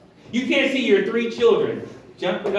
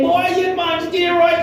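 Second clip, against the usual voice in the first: put-on high, loud, light Jamaican accent; little Jamaican accent; 9 semitones higher, 3 dB louder than usual. Bishop teased over his muscles, light Jamaican accent. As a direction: exclaim it with a hint of Jamaican. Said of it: "he had a little Jamaican accent".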